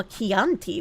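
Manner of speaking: "Chianti" as kee-AHN-tee, slow and settled; corrected pronunciation. Shown as a demonstration. Streamer accepting chat's correction, "Chianti" said kee-ahn-tee.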